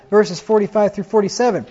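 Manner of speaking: ordinary talk, nothing marked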